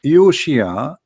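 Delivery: ordinary talk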